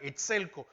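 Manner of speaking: exelco said as ex-EL-co, the stress on the second E. Shown as a demonstration. Preacher teaching the congregation the word, exelco as ex-EL-co. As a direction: say exelco as ex-EL-co with the weight on EL. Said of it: "con acento en la segunda E".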